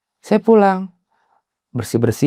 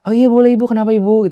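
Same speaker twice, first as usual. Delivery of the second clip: high, polite host voice, louder at the start; ramah dan sopan; 9 semitones higher, 5 dB louder than usual. Retold or imitated. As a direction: greet her obligingly in a raised pitch. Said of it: "ramah, sopan gitu ya". (ramah, sopan, bernada tinggi)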